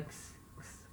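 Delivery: Ks, ks whispered as a bare ks hiss; voiceless ks, no vowel; ks sound. Shown as a demonstration. Teacher demonstrates the X sound as unvoiced ks. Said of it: "esse X com som de K S".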